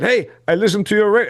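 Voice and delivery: high, poorly faked French accent; mock French accent, badly done; attempted French accent. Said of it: "I can't do the French accent".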